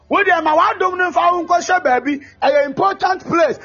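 preaching. Acted. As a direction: preach it out to the congregation. Preaching at full force.

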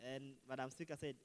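normal talking voice

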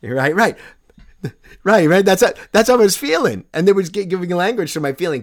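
excited agreement. Real, not acted. Excited agreement about the film.

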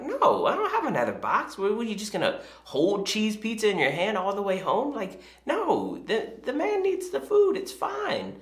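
high, incredulous retort; exasperated dad. Retold or imitated. Dad refusing his daughter's protest, exasperated. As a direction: argue back in mock exasperation, pitch climbing. Dad arguing back, retold.

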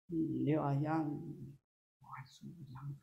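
deadpan, quiet mock praise; flat, joyless praise. Shown as a demonstration. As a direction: mumble the compliment with no joy at all. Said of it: "you don't say".

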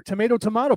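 tomato as to-MAY-to, then to-MAH-to; tomato both ways. Shown as a demonstration. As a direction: dismissively swap tomato from AY to AH. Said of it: "look at those words and go".

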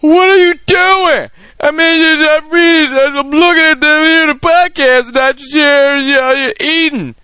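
very high, loud mock complainer; exaggerated squeal of an outraged listener; Twitter commenter voice. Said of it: "That's my Twitter voice".

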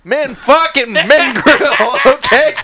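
loud, high macho rant breaking into laughter; hammed-up macho rant dissolving into laughs; laughing mock rant. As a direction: rant about what men do, cracking up.

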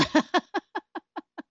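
high, soft real laughter breaking out; helpless high laugh. Real, not acted. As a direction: crack up at your own line. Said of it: "I really lost it".

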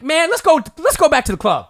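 high, loud imitation of a rallying shout; mock rowdy yell. Imitating the brawling woman telling friends to leave, rowdy yell. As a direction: call the crew out, hammed up.

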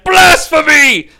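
high, slow theatrical shout; mock outrage. Actor playing Caiaphas giving his big performance, mock-outraged shout. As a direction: belt it out like a rock star.

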